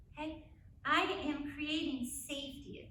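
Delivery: normal talking voice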